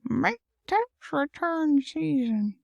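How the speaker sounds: put-on high, slow creaky old-man voice; Herbert. Joking with a cohost, slow high old-man voice. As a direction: stretch it out like a leering old man. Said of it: "that sounded like that guy from Family Guy, the old man".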